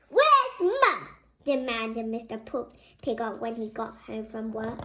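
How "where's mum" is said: high, loud angry demand; loud indignant husband; demanding shout. Acted. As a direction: bark it at the empty house. Mr Piggot demanding.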